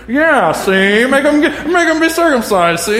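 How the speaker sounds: high, loud squawking parrot with a laugh. Retold or imitated. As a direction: squawk like a cheeky cartoon parrot. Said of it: "the voice I use of the parrot".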